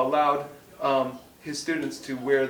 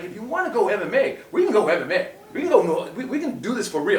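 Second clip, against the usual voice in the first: angry challenge; 5 semitones higher, 4 dB louder than usual. Retold or imitated. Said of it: "I was angry".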